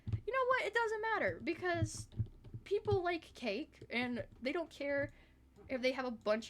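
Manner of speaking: high-pitched, dismissive burst easing down; mock defiance. Streamer talking herself out of worry, high mock defiance. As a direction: squeal it out, brushing the worry aside. Acting out her defiant change of mind.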